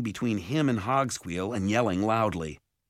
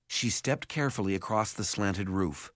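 ordinary delivery

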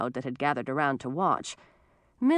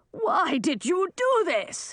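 high, slow, drawn-out cry; high-pitched old man's wail; yelled reproach; 13 semitones higher, slower than usual. A narrator's reading. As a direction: cry out at her in protest. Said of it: "he yelled at Millie".

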